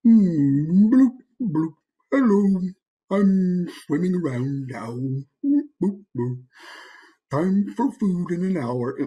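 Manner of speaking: slow, dopey fish with high bloops; lethargic fish. Shown as a demonstration. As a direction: drag it out like a sluggish fish. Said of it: "He looks kind of lethargic and slow".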